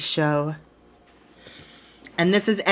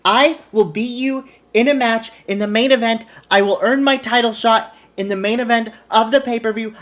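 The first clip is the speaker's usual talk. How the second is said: louder, higher promo declaration; forceful vow; 5 semitones higher, 5 dB louder than usual. Acted. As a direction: declare it hard, punching the words. Acted wrestler vows to win.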